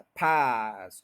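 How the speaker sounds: पास stretched very slow, full energy; drawn out. Shown as a demonstration. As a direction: hold पास long and strong. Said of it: "पूरी energy से बोलें".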